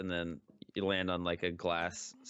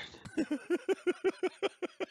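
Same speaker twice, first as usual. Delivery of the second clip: very high, shrieking burst of laughter; a punchy, overtired cackle; laughing helplessly from exhaustion; giddy high laugh. Real, not acted.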